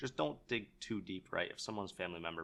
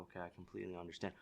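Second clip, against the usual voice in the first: softer, gentle, quicker reassurance; lowered tone; 3 semitones lower, 10 dB quieter, faster than usual. Shown as a demonstration. Salesman soothing a gloomy old grandma, much softer. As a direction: drop your voice for her. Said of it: "you're gonna lower your tone".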